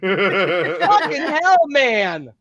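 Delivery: laughing exasperation. Real, not acted. Exasperated at the rival's opinion.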